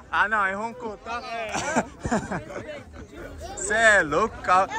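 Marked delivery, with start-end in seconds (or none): 1.3-3.5 long breathy laugh; delighted laugh. Real, not acted. Host laughs as he tries out the guest's car, delighted long laugh. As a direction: laugh on, tickled.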